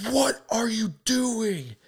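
very high, drawn-out mock yell; exasperated high-pitched yell, re-enacted; retold yell. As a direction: imitate yelling in someone's face, stretching each word. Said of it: "I just yelled right in his face".

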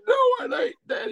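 high, wailing shouted protest; crying kid yell. Retold or imitated. Boy wails at his dad that it is no Elvis cut, high crying shout. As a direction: yell it through tears like a small child.

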